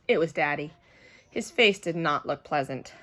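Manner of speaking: usual speaking voice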